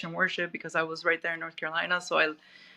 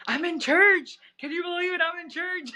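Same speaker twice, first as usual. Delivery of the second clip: high, excited voice of the friend; 10 semitones higher, 4 dB louder than usual. Retold or imitated. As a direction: relay the friend's joyful pride in being at church. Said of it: "And then he was like".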